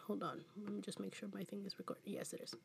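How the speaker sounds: quick unvoiced whisper aside. Real, not acted. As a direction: whisper it quickly under your breath. Aside while checking the recording.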